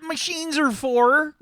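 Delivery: high, loud, slow mum scolding; nagging mum. Acted. Mum arguing with Arthur about phone calls, high and loud. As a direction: brush off his excuse like a stubborn mother.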